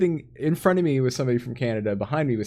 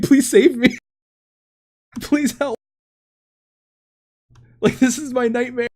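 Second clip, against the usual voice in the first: mock plea for help; 13 semitones higher, 6 dB louder than usual. Pleading text to co-host, retold.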